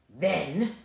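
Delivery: low growly wolf voice; big bad wolf. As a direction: drop low like a menacing wolf. Wolf answers.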